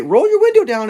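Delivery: calling to a driver to roll the window down. Retold or imitated. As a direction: shout through the window. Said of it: "I will honk my horn and indicate".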